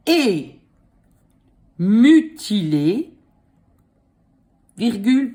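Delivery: dictating. Shown as a demonstration. Dictating slowly.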